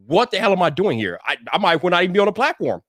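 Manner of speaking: ordinary delivery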